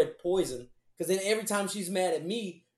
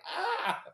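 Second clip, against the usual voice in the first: mock scream. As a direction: mimic a cartoon victim screaming, held back in volume. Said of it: "motherfuckers just screaming and shit".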